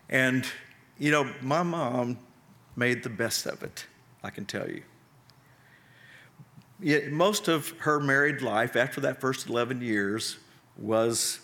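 usual speaking voice

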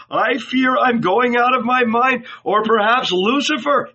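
put-on high, loud, frightened old man; trembling fear. A narrator's reading. Old man fearing for his mind, trembling. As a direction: confess it in rising panic. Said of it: "he said, trembling".

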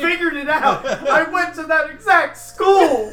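haughty senpai. Acted. Commandant admits he learned haughtiness from senpai school, high and lofty. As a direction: look down on them like an older senpai. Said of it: "Mitsuru Kirijo school".

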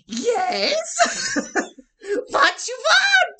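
high, loud, drawn-out crow squawk. Acted. Player recreating the crow voice given to a pet crow, crow voice. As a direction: shriek it like a pantomime crow. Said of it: "the crow, it was like".

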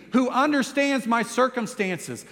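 normal talking voice

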